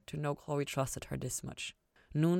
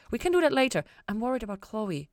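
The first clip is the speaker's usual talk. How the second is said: Ella voice; 7 semitones higher, 4 dB louder than usual. A narrator's reading.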